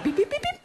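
very high little beeps; comic high-pitched beep noise; imitated beeping. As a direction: squeak out a row of beeps. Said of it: "I was like".

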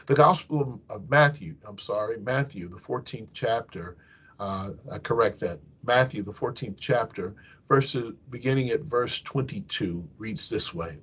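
ordinary talk

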